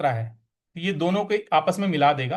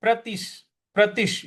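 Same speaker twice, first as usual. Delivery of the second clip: Pratish with a firm push; accent the syllable Pratish; stress on Pratish; 4 semitones higher than usual. Shown as a demonstration. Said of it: "यहां पे प्रतिश पे हमें आघात देना है".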